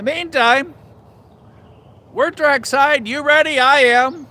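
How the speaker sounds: pumped up, ready for the train; excited. Real, not acted. Excited trackside, train coming.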